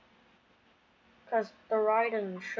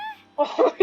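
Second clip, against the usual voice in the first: short laugh; 7 dB louder than usual. Real, not acted. Streamer laughs that A is broke.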